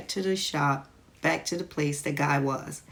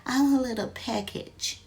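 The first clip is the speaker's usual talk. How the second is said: old lady imitation; 6 semitones higher, 3 dB quieter than usual. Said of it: "this old lady used to say".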